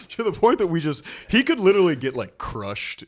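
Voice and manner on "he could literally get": ordinary talk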